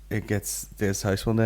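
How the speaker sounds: normal talking voice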